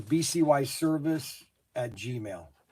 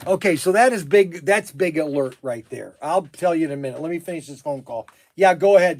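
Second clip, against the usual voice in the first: louder, hurried aside; urgent and excited; 2 semitones higher, 10 dB louder than usual. Real, not acted. Owner telling someone to wait, urgent and loud. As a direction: rush to get back to the call.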